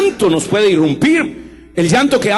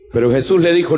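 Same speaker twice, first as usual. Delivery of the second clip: ordinary talk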